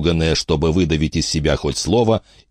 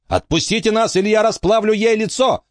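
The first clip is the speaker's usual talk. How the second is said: high-pitched cry at contained volume; shouted threat. A narrator's reading. Emma threatening Frankie to free friends, high shouted threat. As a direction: call out the threat high and urgent. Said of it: "крикнула Эмма".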